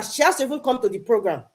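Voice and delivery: usual speaking voice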